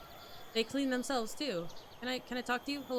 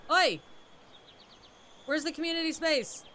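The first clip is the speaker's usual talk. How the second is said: higher, louder calls to be heard; calling out; 6 semitones higher, 8 dB louder than usual. Real, not acted. Player calling to villagers who ignore them, loud. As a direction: demand an answer across the square.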